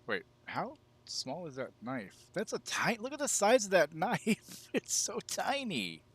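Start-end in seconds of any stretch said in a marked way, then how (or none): 2.3-6.0 high, loud, slow disbelief; amazed. Real, not acted. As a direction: gape at how tiny it is. Gasping at a tiny knife.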